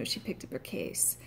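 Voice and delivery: put-on quiet Irish-accented quote, "case" for keys; imitated Irish man. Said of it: "a man said case instead of keys".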